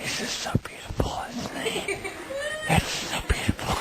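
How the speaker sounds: breathy adoring whisper. Real, not acted. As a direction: whisper it lovingly. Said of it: "we have to whisper".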